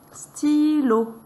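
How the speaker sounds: "Stylo" high and drawn out; syllable by syllable. Shown as a demonstration. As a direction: stretch both syllables of "Stylo". Teacher models word for syllables.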